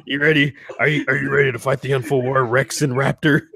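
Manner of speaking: hammed-up talk-show rant, a bit fast; mock Alex Jones rallying. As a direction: pump the kids up like a fired-up host. Alex Jones rallies his kids.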